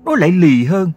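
wife scolding her husband, high-pitched and loud. A narrator's reading. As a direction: cut in to chide the husband in a high woman's voice. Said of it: "dỗ mỏ chen ngang".

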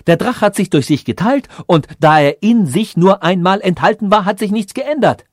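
high, loud complaint from a king; agitated calling out. A narrator's reading. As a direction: call out the bad news in indignation. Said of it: "rief er".

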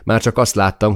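usual speaking voice

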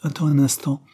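implore, with the voice lifted; imploring. A narrator's reading. Charlotte to fleeing Caroline, imploring. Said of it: "implorait Charlotte".

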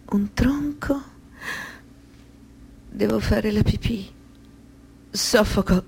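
high, voiceless gasp of words; frightened whisper. Acted. A woman relives a childhood memory, in a frightened whisper. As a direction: whisper it as if the memory chokes you.

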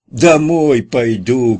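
loud, high and very slow shout. A narrator's reading. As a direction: bellow it so a deaf ear hears. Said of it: "крикнул Кутя".

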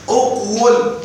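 "أقول" as uq-wul, sounded w; underlying form. Shown as a demonstration. Teacher showing where the vowel moves from, split form. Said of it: "نقلت ضمة الواو إلى القاف".

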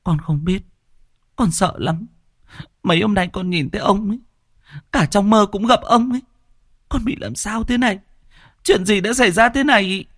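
put-on high girl's voice, fast and scared; frightened daughter. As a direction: plead like a terrified young girl.

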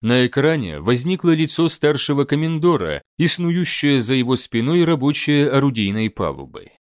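usual speaking voice

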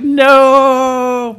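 high, loud cry of despair; anguished shout. Retold or imitated. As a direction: yell no like you just lost everything. Dying in the game, reacting.

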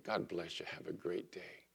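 very soft, low farewell; gentle blessing. Retold or imitated. Customer blessing the mail lady, hushed and gentle. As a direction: let it drop almost to a hush.

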